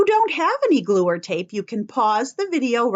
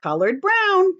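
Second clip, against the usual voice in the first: playful reveal; 7 semitones higher than usual. Shown as a demonstration. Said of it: "you guessed it".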